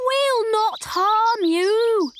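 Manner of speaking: high, loud, stretched-out call. A narrator's reading. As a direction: call reassurance after someone running away. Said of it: "called the bears".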